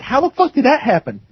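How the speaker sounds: high-pitched disbelieving question; retold disbelief; incredulous. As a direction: ask it astonished, pitch jumping up. Reacting to uncle's missing teeth.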